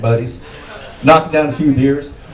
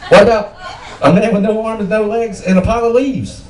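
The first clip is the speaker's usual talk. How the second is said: normal talking voice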